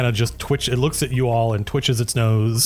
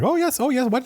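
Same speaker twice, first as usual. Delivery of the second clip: high, excited eager voice; eager Solvi. Acted. Solvi happy to trade, high eager voice. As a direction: agree eagerly and quickly. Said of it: "He's like".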